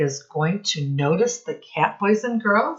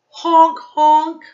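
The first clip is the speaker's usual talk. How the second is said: much higher, louder honks then bouncy words; louder, higher duck sounds; honking duck sounds; 5 semitones higher, 7 dB louder than usual. Shown as a demonstration. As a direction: honk like a goose for the class. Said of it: "All sound words".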